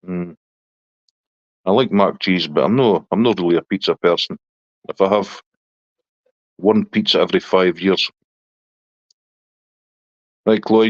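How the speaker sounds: ordinary talk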